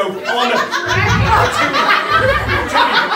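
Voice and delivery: ratatouille whistled out high and loud. Pretend. Mocking how Manx folk would order, whistling ratatouille. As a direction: whistle the word ratatouille through your teeth. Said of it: "Do you whistle and go".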